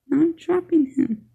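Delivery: ordinary talk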